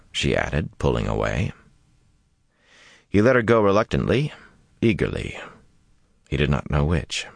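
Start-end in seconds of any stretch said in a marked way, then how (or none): none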